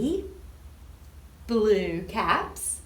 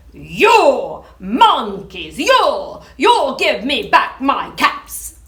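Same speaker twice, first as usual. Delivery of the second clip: loud, angry demand; angry peddler; 11 dB louder, slower than usual. Acted. Peddler scolds the monkeys in the tree, loud and angry. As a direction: yell up at the monkeys in anger. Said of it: "This made the peddler angry".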